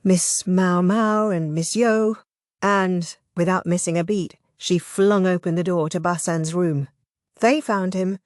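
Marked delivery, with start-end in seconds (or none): none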